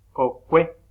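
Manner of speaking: Kokue with the last vowel E hit loud; stress on final E. Shown as a demonstration. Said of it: "Suena más fuerte la e". (Kokue con la e final acentuada y fuerte)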